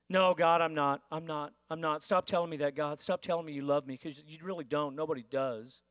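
quiet, broken protest against being loved; hurt, low refusal. Retold or imitated. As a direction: push God away in a low, hurt murmur. Person rejecting God's love.

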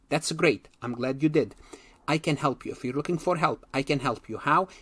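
ordinary delivery

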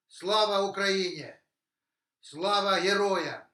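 slogans called out to a crowd; rally speech. Real, not acted. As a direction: proclaim it like a rally cry. Closing patriotic slogans of speech.

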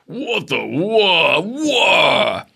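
high, swooping mock cry; hammed-up alarm, pitch jumping up then falling; tripping giant's yelp. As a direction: wail it loud as if toppling over. Giant trips over the wire.

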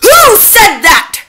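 loud, high, stretched-out snap; snapped. A narrator's reading. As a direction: snap furiously, drawing the words out loudly. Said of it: "she snapped".

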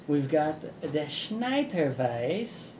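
put-on slow, playful German accent. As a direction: say it like a German brewer. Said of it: "my little weird German accent".

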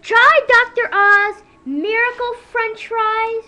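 loud, slow commercial pitch; ad announcer. Pretend. Host pitches Miracle French Fries, loud slow ad voice. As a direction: sell the fries like a TV ad.

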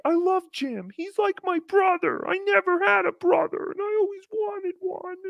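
put-on high, childish voice of a kid; twelve-year-old. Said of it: "a twelve-year-old being like".

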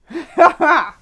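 a loud, high burst of laughter; real laugh after the bit. Real, not acted. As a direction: break into a laugh at the joke. Laughs at her own joke.

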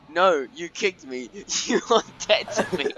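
reading the DMs coldly; cold, offended message read; mock coldness. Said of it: "okay, it says".

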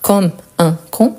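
"comme un con" broken up with pauses; separated words. Shown as a demonstration. Teacher explains the double meaning, separated words. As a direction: say "comme", "un", "con" one at a time. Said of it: "si on sépare".

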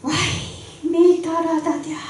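high-pitched amazed wai; surprised gasp. Acted. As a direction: gasp in delight at the food. Bride marvels at rice cakes. (高い声で驚く嫁)

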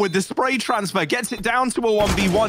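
rising hype on the clutch; excited play-by-play. Real, not acted. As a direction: call the clutch shot, building excitement. Live play-by-play clutch call.

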